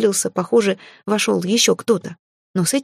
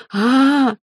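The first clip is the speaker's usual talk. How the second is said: high yelled "aaah" to stop the madhouse; loud scream; 7 semitones higher, 4 dB louder than usual. Acted. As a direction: scream to silence the room. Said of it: "громко заорала я".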